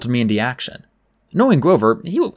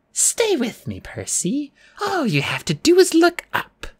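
put-on high, coaxing old-woman voice; soothing. A narrator's reading. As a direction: lure gently with a high, sweet voice. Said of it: "she told me soothingly".

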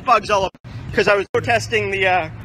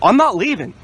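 raised, defiant refusal; worked up; 4 semitones higher, 6 dB louder than usual. Real, not acted. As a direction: refuse loudly and angrily. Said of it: "don't have to get all excited".

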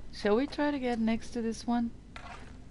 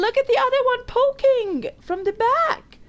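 excited; 5 dB louder than usual. Real, not acted. Excited pointing at snake.

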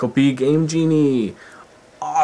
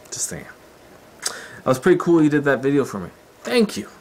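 ordinary talk, nothing marked

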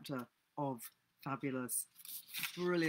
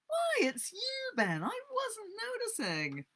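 very high, drawn-out mock astonishment; slow, squeaky-high innocent surprise; feigned surprise; slower than usual. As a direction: act as if you've only just noticed him. Tom feigns surprise at Ben.

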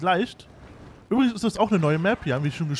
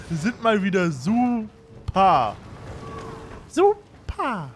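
sarcastic super; much slower than usual. Real, not acted. Sarcastic about own fails.

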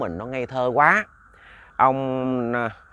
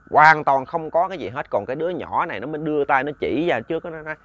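plain reading voice

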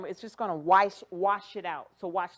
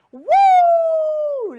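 very high, long, loud woo; loud whoop; 15 dB louder than usual. Real, not acted. Host cheering viewers on after a count, high loud whoop. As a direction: let out a big celebratory whoop.

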